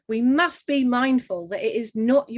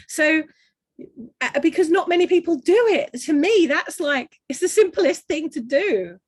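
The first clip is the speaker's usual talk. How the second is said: higher and louder, fired up; excited; 7 semitones higher, 5 dB louder than usual. Real, not acted. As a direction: burst out with enthusiasm. Enthusiastic point, voice jumps.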